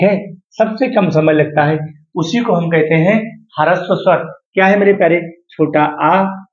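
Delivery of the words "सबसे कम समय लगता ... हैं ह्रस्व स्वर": ordinary talk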